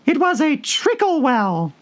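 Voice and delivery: very high, slow little dormouse squeak; a tiny creature answering after a long think; high, slow mouse voice; squeaky Dormouse. A narrator's reading.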